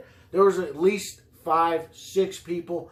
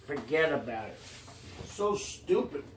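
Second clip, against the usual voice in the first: lightly put-on New York wiseguy accent; mock New York mobster accent; 6 dB quieter than usual. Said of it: "those accents".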